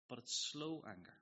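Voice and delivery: ordinary talk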